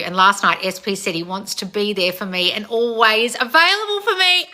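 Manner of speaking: rising toward a squeal; building excitement. Real, not acted. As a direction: read good news with mounting thrill. Reads fan's good news, building.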